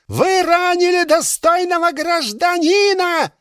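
high, shrill outrage; indignant woman. Acted. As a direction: shriek it indignantly.

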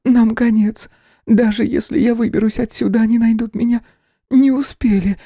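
put-on scared young woman, trembling; trembling whisper. A narrator's reading. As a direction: whisper it, shaking with fear. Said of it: "прошептала она, дрожа всем телом".

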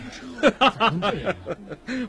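high, long scornful laughter; defiant laugh. Acted. Prisoner mocking the guard's charge, defiant laughter.

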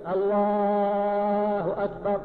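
الله held very long, "allaaaaaahu akbar"; very slow الله, quick أكبر; long vowel after lam. Shown as a demonstration. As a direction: sustain the A after the L in الله on one level note. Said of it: "الألف التي بعد اللام".

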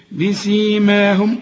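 "بسيماهم" slow, lips closed firmly on the mim, then the long aa held; careful tajweed reading of "بسيماهم"; "بسيماهم" with the alif stretched. Shown as a demonstration. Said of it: "وبعد الميم ألف عشان تمد".

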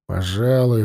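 ordinary delivery, nothing marked

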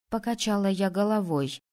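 ordinary delivery, nothing marked

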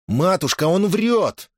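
high, rising youthful outburst; indignant protest. A narrator's reading. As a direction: burst in to deny the accusation. Son interrupts in protest. (возмущённый выкрик сына)

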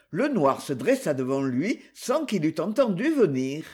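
plain reading voice

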